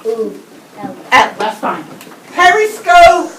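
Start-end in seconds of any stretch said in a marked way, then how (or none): 2.3-3.3 "Periscope" called out louder and higher; loud model word. Shown as a demonstration. Teacher modelling the word before swapping the first sound, louder. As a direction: present "Periscope" clearly to the class. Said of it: "A new rhyming word".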